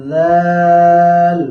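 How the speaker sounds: teacher calling out জাল slowly; drawn-out loud letter demo. Shown as a demonstration. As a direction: hold জাল out strongly like a chant. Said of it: "এই জাল হরফটি উচ্চারণের সময়".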